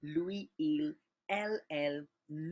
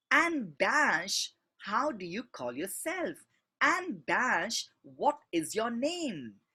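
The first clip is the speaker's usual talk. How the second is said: And dash said louder and higher; emphatic reading; 4 semitones higher, 4 dB louder, slower than usual. Shown as a demonstration. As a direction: punch the And at the start. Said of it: "here also we are trying to emph-, emphasize".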